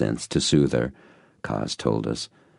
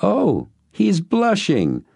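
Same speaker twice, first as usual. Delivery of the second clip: high, slow delighted woman; teasing woman; 4 dB louder, much slower than usual. A narrator's reading. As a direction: coo it playfully. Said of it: "she spoke quickly".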